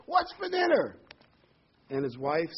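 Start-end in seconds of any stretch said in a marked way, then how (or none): 0.1-1.0 high, loud yell at close range; acted yell. Husband gets right up in his wife's face, high loud yell. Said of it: "gets right up in her face and yells".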